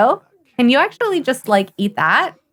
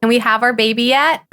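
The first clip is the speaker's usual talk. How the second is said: ordinary delivery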